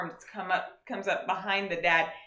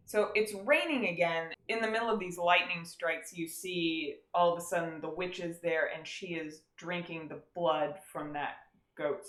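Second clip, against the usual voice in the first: ordinary delivery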